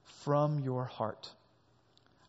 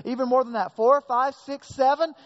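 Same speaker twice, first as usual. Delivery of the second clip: louder, rising count as he stretches; Peter upping the offer; 7 semitones higher, 7 dB louder than usual. Retold or imitated. Peter offering Jesus more forgiveness, eager and louder. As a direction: pile the numbers on eagerly. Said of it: "But Peter says".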